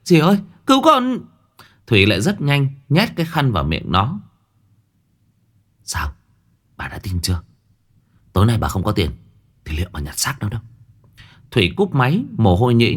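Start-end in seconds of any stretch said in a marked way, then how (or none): 0.0-1.2 high, loud cry for help; kidnapped girl screaming for help. Acted. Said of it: "Thanh vội gào lên". 5.8-10.6 lower, softer, menacing rasp; a hint of a put-on husky threat; faked hoarse voice. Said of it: "giả giọng khàn khàn".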